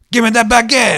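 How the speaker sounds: loud, high, mock French demand; mock French accent. Vivendi demanding the baguette from Ubisoft, loud mock French accent. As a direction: grab it in a hammed-up French accent. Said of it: "With my perfect accent".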